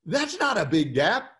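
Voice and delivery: laughing through the words; chuckling, amused disbelief. Retold or imitated. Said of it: "I started laughing".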